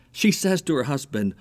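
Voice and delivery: ordinary delivery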